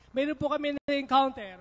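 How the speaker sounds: normal talking voice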